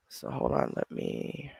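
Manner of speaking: unvoiced whisper; whispered aside. Real, not acted. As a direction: breathe it under your breath.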